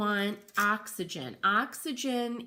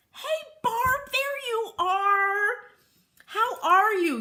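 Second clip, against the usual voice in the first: excited greeting; 12 semitones higher, 5 dB louder than usual. Real, not acted. Greeting viewer arriving on stream.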